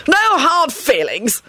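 slow, high-pitched plea; desperate. A narrator's reading. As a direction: stretch it out in desperate appeasement. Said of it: "he blurted out desperately".

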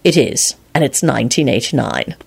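low, terse answer. A narrator's reading. Lupin answering Quentin about the year, terse. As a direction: give just the facts. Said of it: "Lupin replied tersely".

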